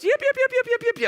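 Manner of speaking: very high, yappy little-dog yips; high mock chihuahua yipping. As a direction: yap it like a tiny dog. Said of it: "You remind me of a chihuahua".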